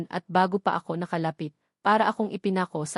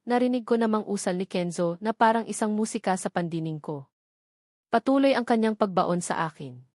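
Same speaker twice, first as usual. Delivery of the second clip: ordinary delivery, nothing marked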